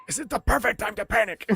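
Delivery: high, strained aside to a buddy; Tom Hanks under his breath. Acted. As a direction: panicky mutter in a put-on voice. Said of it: "talking under his breath".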